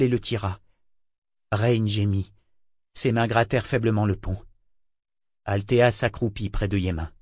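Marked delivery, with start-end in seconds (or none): none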